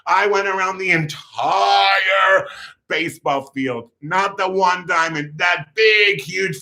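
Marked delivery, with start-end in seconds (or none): 0.1-2.5 high and loudening, with "entire" hugely drawn out; emphatic boast. Real, not acted. Storyteller revealing the huge lap, "entire" stretched emphatically.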